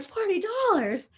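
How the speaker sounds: high-pitched retold disbelief; acted-out happy shock, pitch jumping up; astonished echo. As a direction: exclaim it, amazed at the sum. Echoes news of surprise gift.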